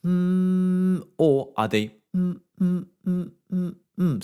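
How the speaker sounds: high hummed mm, starting and stopping; choppy hum demo. Shown as a demonstration. Voice teacher showing a choppy stop-start hum. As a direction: pulse the hum on and off. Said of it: "are your vocal folds constantly buzzing".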